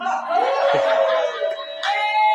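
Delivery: high laugh; amused laugh at own story. Real, not acted. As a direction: laugh after delivering the punchline. Laughs after story punchline.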